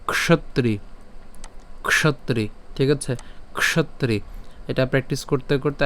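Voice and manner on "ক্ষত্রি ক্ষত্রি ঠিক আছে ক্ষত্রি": ক্ষত্রি said with a joined kṣ cluster, kshotri; teacher models ক্ষত্রি as kshotri; pronunciation demo. Shown as a demonstration. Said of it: "উচ্চারণটা আমি একটু বলে দিচ্ছি".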